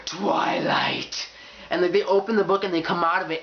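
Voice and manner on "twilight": hissed, fanatical whisper; mock creepy whisper. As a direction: whisper it like an obsessed fan. Acting an obsessed Twilight fan answering.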